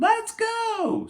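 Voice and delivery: put-on high, excited little-kid cry; excited kids' shout. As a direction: shout it like kids ready to go. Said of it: "The kids shouted".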